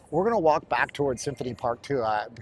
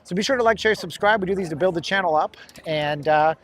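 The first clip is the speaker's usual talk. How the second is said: louder, higher channel-promo delivery; sponsor spot pitch; 5 semitones higher, 5 dB louder than usual. Real, not acted. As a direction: pitch the channel to camera with energy. Delivers his sponsor spot to camera.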